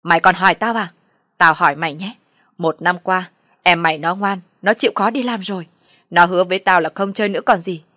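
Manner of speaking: loud, angry mother's tirade; heated accusatory outburst; irate retort. Acted. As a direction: round on the son and blame his wife. Mother rages back at her son.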